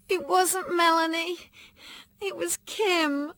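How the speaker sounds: high, slow, tearful confession; a child breaking down in tears; sobbing. A narrator's reading. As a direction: sob the name out. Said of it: "I sobbed".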